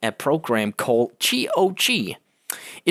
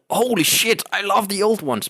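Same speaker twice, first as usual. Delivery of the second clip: excited exclamation; 9 semitones higher than usual. Retold or imitated. Excited memory of seeing the news.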